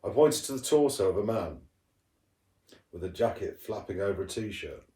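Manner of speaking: ordinary delivery, nothing marked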